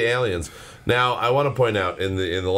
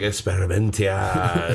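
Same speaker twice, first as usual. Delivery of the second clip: mock Spanish-accented drawl of the word; lightly hammed-up fake Spanish accent, slow; slow mock Spanglish, barely put on; bad Spanglish; 2 semitones lower, slower than usual. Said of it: "like bad Spanglish versions".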